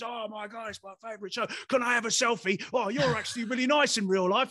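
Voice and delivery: excited cabbie asking for a selfie; starstruck fan. Retold or imitated. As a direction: gush like a fan who met a star. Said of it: "he's just asking me all these questions".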